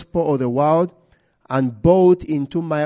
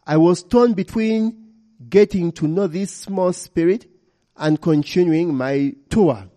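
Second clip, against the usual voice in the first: usual speaking voice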